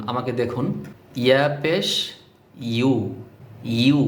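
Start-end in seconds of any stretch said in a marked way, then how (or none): 1.2-4.0 "ইউ" as an isolated, careful syllable; careful pronunciation demo. Shown as a demonstration. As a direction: spell "ইয়া পেশ" then sound "ইউ" on its own. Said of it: "কিভাবে করছি খেয়াল করুন".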